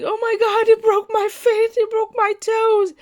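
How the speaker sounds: high, loud imitation of hurt dad; wailing father. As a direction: wail in mock agony. Father wailing over injured foot.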